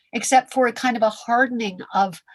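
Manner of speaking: ordinary talk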